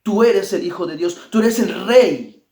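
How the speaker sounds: sudden loud cry of the demons; hammed-up loud demon cry. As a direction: shout it like spirits trembling before him. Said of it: "daban voces diciendo".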